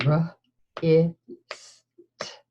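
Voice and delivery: R, I, st, each sound split apart; sounding out words. Shown as a demonstration. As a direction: segment wrist into R, I, st with pauses. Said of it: "let's say the sounds in wrist again".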